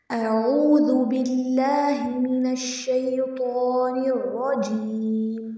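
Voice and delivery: slow, drawn-out tajweed recitation; Quran recitation. Shown as a demonstration. Teacher reciting slowly for the class. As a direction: recite each word slowly and clearly.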